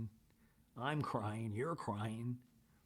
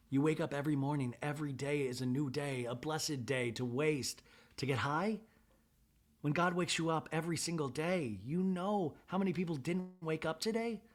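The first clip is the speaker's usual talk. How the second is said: retold motherly sermon, higher pitched; impassioned admonishing; 6 semitones higher, 4 dB louder than usual. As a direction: plead insistently as an imitated mother, pitch climbing. Retelling a mother admonishing her son.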